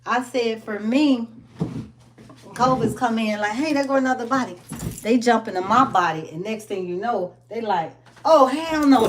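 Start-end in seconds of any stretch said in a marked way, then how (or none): 3.5-4.6 quick, bright mock call of a virus; hammed-up glee, rushed; excited covid voice. Covid entering body, playful voice. 8.2-9.1 high, loud 'oh hell no' of a spooked virus; mock alarmed virus. Virus voiced fleeing immune system.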